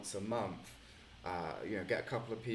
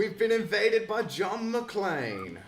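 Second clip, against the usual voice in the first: mock alarm; 11 semitones higher, 11 dB louder, slower than usual. Real, not acted. Friend suddenly joins the call.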